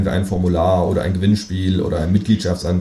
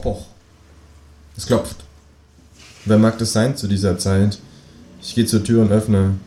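ordinary delivery, nothing marked

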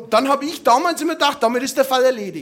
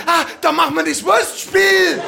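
eager saleswoman; 5 semitones higher, 5 dB louder than usual. Retold or imitated. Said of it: "Und dann hat die gesagt".

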